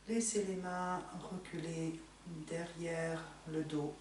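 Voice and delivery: normal talking voice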